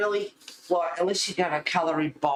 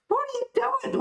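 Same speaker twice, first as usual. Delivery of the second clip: much higher, mock disbelief; incredulous squeal; much faster than usual. Vlogger mocking people who rip pages out, high incredulous squeal. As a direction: shriek it in playful disbelief.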